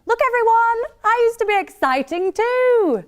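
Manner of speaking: loud, high-pitched show-off shout; mock boasting. Mimicking her own Facebook post, mock boasting. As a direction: announce it to the world, hammed-up.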